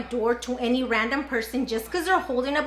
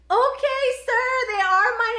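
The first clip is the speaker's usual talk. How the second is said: sheepish surprise; 9 semitones higher, 3 dB louder than usual. Retold or imitated. Sudden realization, backtracking.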